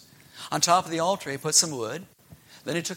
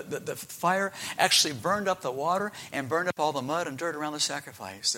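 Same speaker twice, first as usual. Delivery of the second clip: usual speaking voice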